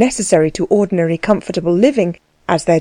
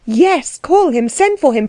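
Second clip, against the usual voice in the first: high, agitated command; urgent insistence; 7 semitones higher than usual. A narrator's reading. Anna to Frau Dellwig about the coachman, urgent insistence. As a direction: press for action at once.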